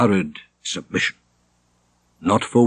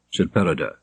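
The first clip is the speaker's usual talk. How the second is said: ordinary delivery, nothing marked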